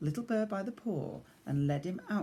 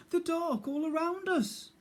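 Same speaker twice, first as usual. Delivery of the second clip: high small timid voice; small scared bear cub. Acted. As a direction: speak as a frightened little cub.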